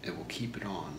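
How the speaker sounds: ordinary delivery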